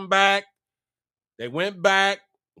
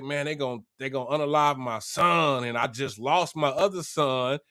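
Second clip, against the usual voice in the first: low, softer reluctant protest; reluctant father; 5 semitones lower, 4 dB quieter than usual. Retold or imitated. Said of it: "Jacob was reluctant".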